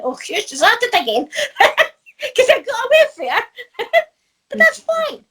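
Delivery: laughing, voice climbing high. Real, not acted. Lollipop lady laughing at her cousin's remark, high and giggly. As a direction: giggle through the cousin's words.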